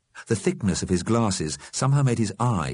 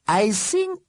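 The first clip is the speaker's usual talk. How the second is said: high, carefully placed character voice. A narrator's reading. Einstein interrupts Fletcher, careful. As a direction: pick the words cautiously, pitched high. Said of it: "said Mister Einstein carefully".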